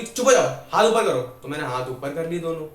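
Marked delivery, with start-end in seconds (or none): 0.0-1.2 loud, raised-pitch command; strict teacher's order. Retold or imitated. Madam silencing a cheeky pupil, loud scolding. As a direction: order a pupil sharply and loudly.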